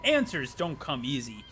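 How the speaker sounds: slow, grand narrator questions; hammed-up storybook narrator; dramatic narrator. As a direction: pose the mystery like a theatrical narrator.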